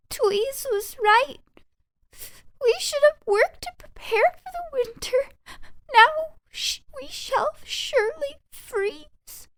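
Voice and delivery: quake with cold and fear; cold, frightened mice. Acted. Freezing mice panicking in the snow, high and shivering.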